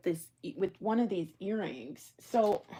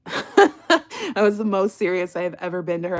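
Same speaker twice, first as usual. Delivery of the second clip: laughs through the line, loud; breaking into laughter mid-story; laughing; 9 dB louder than usual. Real, not acted. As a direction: speak while cracking up. Laughing while telling the story.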